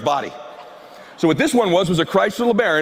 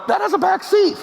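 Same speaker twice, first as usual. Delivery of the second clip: mock onlooker; 13 semitones higher than usual. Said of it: "looking at the car going".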